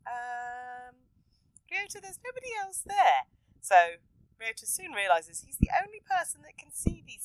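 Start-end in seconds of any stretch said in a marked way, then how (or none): 0.1-3.3 lightly voiced puzzled adults. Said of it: "They're all like going".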